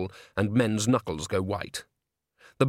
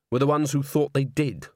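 ordinary delivery

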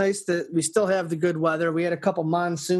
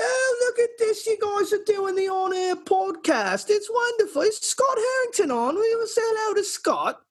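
sustained falsetto country accent; put-on accent. Shown as a demonstration. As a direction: ham up a shrieky-high accent for the co-hosts. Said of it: "what other accent do you need".

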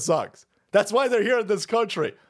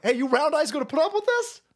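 higher, put-on mocking voice for the quoted men; a lightly put-on accent, higher; mock Chinese American voice; 9 semitones higher than usual. Retelling how Chinese Americans taunted the crowd, higher mock voice. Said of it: "The Chinese Americans were like".

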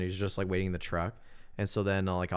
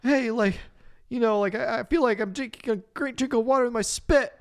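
high, woozy mock rambling; squeaky thirsty babble; dazed high voice; 14 semitones higher than usual. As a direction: sound parched and delirious. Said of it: "he's like".

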